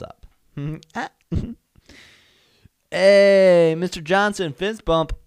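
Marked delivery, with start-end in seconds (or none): 2.9-5.1 loud, high, eager acted hail; calling out a greeting. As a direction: greet the principal with big energy. Acts greeting the principal.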